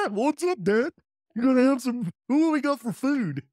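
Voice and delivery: high, eager pet voice; dog voice. Acted. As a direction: talk as an excited puppy. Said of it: "give your dog a voice".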